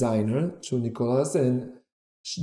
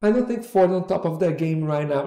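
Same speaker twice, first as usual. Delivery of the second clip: higher-pitched, dismissive mock hesitation; snooty attitude; 5 semitones higher than usual. Would-be model brushing off Ford to the agent, with attitude. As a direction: imitate a dubious girl looking down on the agency. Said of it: "She had a bit of an attitude".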